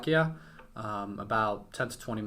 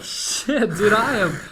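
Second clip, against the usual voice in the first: retold panic, loud and high; panicked outburst; 11 semitones higher, 11 dB louder, slower than usual. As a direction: reenact nerves spiking on a ringing call. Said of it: "my heartbeat just goes up and up".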